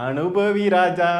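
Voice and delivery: slow, drawn-out mock taunt; gloating taunt. Gloating at the star's fans, slow mocking taunt. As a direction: stretch it out, savouring their trouble.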